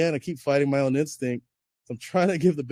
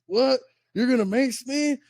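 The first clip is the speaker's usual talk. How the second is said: mocking ranger; 9 semitones higher than usual. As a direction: squeak out his disbelief to make him sound silly.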